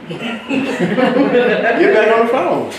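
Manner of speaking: slow, laughing through the words; amused, drawn-out repeat. Shown as a demonstration. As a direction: drag it out while cracking up.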